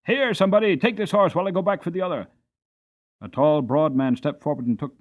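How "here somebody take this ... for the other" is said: higher, loud call, then faster; shouting. A narrator's reading. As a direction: shout for help across the yard. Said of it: "and shouted".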